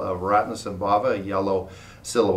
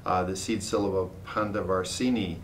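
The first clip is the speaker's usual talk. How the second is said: normal talking voice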